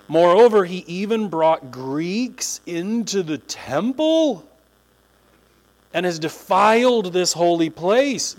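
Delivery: slow, weighty accusation; drawn-out outraged charge; solemn charge. Acted. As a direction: read it like a grave indictment. Reading the crowd's charge.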